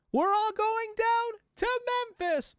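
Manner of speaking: hammed-up high-pitched call; Alex Lee. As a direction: imitate a voice actor's big high cry. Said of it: "let's just say Alex Lee".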